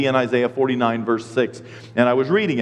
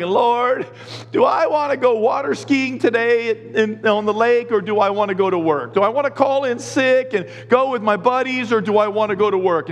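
slightly higher, mock-earnest appeal to the Lord; mock pleading prayer; 7 semitones higher than usual. Lucky dipper asks the Lord about skiing, mock pleading. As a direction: act out a wishful prayer.

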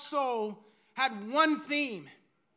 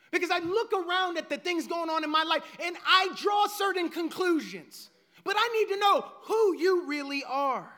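ordinary talk

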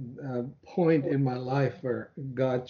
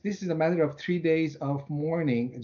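ordinary talk, nothing marked

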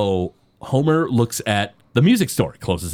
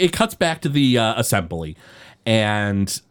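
ordinary talk, nothing marked

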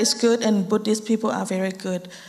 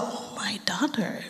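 hushed, breathy whisper of a moved father; dad's awed whisper; 5 dB quieter, slower than usual. Retold or imitated. Retelling her dad seeing her change, whispered softly. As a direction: whisper it like a dad amazed at his daughter. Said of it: "He's all".

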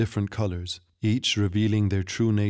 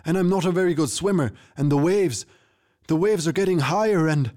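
much higher, fast frantic protest; panicked tavern owner pleading to stay aboard; horrified pleading; 9 semitones higher, 4 dB louder than usual. A narrator's reading. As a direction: beg not to be thrown in the sea. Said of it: "eyes widened in horror".

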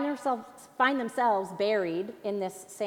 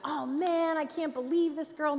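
higher-pitched exasperated griping; hammed-up "can't believe it" whine; mock complaining; 7 semitones higher than usual. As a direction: grumble in a raised voice, imitating a fed-up prisoner. Acting out imagined dungeon complaint.

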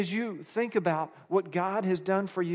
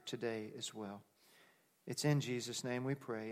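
soft, low pastoral prayer; quiet prayer from the pulpit; 4 semitones lower, 5 dB quieter than usual. Acted. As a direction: pray gently over the congregation.

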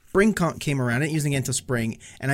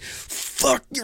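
retold angry cursing; 10 semitones higher than usual. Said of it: "I get all mad".

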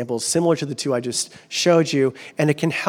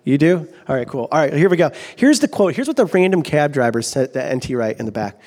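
normal talking voice

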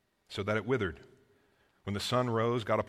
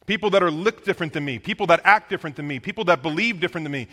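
louder, higher preaching, fast at the end; 6 semitones higher, 10 dB louder than usual. Acted. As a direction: drive the point home to the church. Preaching builds up.

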